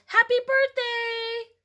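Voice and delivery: put-on high, loud, slow child's yell. A narrator's reading. Little Cassie yells at Aunt Denise, high and loud. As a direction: belt it out like an excited toddler. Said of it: "she yelled".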